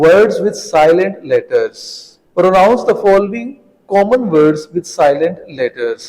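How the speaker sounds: slow, deliberate reading voice for the class; slow textbook read-aloud. Acted. Teacher reading the question aloud slowly to pupils. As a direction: read each word out slowly to students. Reads exercise heading.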